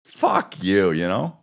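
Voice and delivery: usual speaking voice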